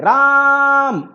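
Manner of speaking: राम with the आ stretched long and high; drawn-out vowel. Shown as a demonstration. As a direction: pull the vowel of राम out like a call. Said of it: "जोर दे करके कर रहे हैं ... या फिर और जोर लगा रहे हैं". (आ को खींचकर लंबा राम)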